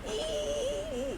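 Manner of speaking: tiny high squeak; mouse squeak. Retold or imitated. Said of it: "Why does he sound like a mouse?".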